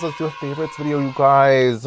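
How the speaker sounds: same words, back near her own pitch; calmer excited repeat. Real, not acted. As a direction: repeat it, settling down. Repeats the idea.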